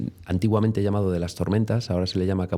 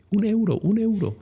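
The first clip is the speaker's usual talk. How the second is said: much higher, squeaky repeated demand; mimicking the lady; 12 semitones higher than usual. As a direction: replay the lady's nagging in a squeak. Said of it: "recordamos la situación como la de". (imitando a la señora, voz muy aguda)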